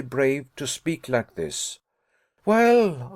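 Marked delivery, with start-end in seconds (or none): 2.4-3.1 higher, louder single word flung out; cried out. A narrator's reading. Nephew cries out to his uncle, higher and louder. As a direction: burst out at him. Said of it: "I cried".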